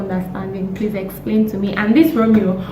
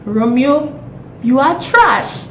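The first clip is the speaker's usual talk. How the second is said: slow contemptuous verdict; 5 semitones higher, slower than usual. Acted. Addressing Romeo directly.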